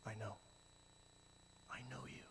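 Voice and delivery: whispered loving God voice; tender God. Pretend. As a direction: reassure a hurting child. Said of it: "just goes".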